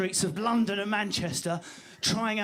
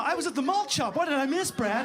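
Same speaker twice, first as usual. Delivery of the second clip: exaggerated high-pitched American buddy; Biff; 5 semitones higher than usual. American man's friend joins the pick-up, exaggerated American pal. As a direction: ham up an eager American pal. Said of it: "Here comes Biff now".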